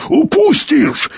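very high, drawn-out cry; frantic cry. A narrator's reading. Explorer fears losing the sight, crying out frantically. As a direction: cry out in desperate, unnatural voice. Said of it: "не своим голосом закричал Юрковский".

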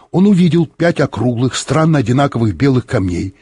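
usual speaking voice

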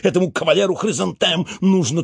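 plain reading voice

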